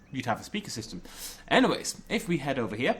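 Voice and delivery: ordinary talk, nothing marked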